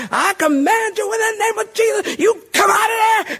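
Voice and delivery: very high, frantic yelled command; mock screaming exorcist. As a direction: imitate an over-the-top exorcist screaming at a demon. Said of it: "people were yelling and screaming and spitting".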